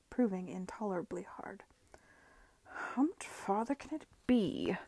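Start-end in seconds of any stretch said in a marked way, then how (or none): 2.6-4.8 out of breath, slow words; puffing from the long walk. Acted. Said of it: "puffed Arthur".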